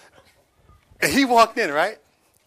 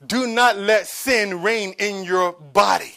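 slow quoted verse; 7 dB louder, slower than usual. Shown as a demonstration. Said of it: "he says that in verse".

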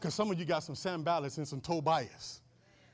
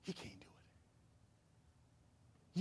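whispering; 10 semitones lower, 22 dB quieter, much slower than usual. Acted. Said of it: "whispering in your ear".

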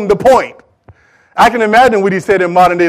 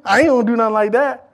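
higher-pitched indignant listener; mock disbelief; 5 semitones higher than usual. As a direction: act out a scoffing churchgoer. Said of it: "You look at me like".

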